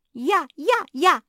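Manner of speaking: very loud, high, eager shouts; yelling. A narrator's reading. Жози claiming the next wish, yelling. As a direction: yell to be picked first. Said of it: "завопила Жози".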